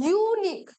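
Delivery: ইউনিক said as "yoo-nik", with a clear y-glide at the start; pronunciation demo. Shown as a demonstration. Teacher modelling ইউনিক as "yoo-nik" for her students. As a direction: sound out ইউনিক with a "you" onset, loud and high. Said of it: "Consonant and vowel সাউন্ডটার উপর base করে শুনতে হয়".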